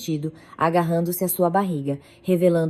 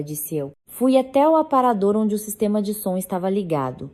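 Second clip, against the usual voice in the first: ordinary delivery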